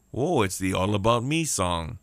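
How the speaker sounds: ordinary talk, nothing marked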